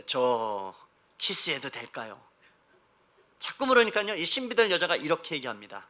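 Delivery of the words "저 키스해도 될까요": earnest cautious groom. Retold or imitated. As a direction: ask gravely and hesitantly, drawing it out. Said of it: "늘 진지하게 묻습니다". (낮고 느리게, 진지하고 조심스럽게 묻는 신랑)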